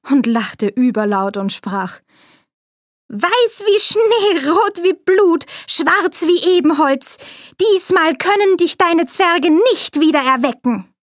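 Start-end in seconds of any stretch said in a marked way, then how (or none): 3.1-10.9 hoch, langsam und triumphierend; grausige Königin. A narrator's reading. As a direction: koste den Sieg über Schneewittchen genüsslich aus. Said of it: "mit grausigen Blicken". (die Königin über dem toten Schneewittchen: hoch und hämisch)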